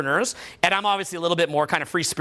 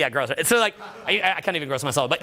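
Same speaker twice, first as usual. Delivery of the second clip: usual speaking voice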